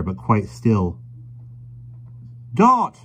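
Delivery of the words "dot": high, loud call; alarmed cry. A narrator's reading. Carrier calling to his stricken wife, alarmed cry. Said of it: "cried the carrier".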